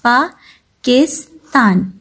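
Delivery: Pakistan slowly broken as "paa - kis - taan"; split into three syllables. Shown as a demonstration. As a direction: say Pakistan in three separate beats. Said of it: "three parts में एक साथ".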